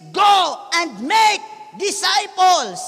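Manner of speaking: preaching the command at full force; proclaimed command. Acted. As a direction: drag out each word like a battle cry. Preacher quoting the Great Commission.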